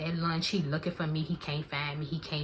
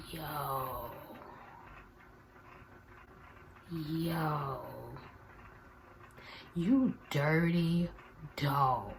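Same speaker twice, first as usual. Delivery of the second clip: much softer, slow disbelieving murmur; stunned under-breath; 6 dB quieter, much slower than usual. Retold or imitated. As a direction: say it to yourself, stunned. Silent reaction to his phone.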